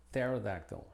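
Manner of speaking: pterodactyl said with a silent p, starting on the t; silent p demo. Shown as a demonstration. Teacher showing learners the silent p in pterodactyl. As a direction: open pterodactyl on the t and drop the p. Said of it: "Con T".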